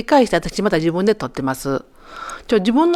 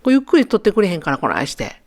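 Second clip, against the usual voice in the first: usual speaking voice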